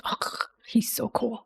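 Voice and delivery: breathless hushed whisper, slowing at the end; swooning whisper over a cool character; awed whisper. Real, not acted. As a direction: whisper it like a starstruck fan. Fangirling over Boreas lore.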